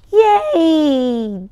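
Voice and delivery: loud happy cheer; child delighted by candy, cheering. Acted. Baby gets candy.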